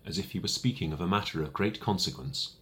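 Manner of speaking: ordinary delivery, nothing marked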